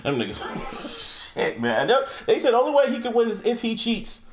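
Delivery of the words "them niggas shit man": wheezing laugh. Real, not acted. Laughing through words.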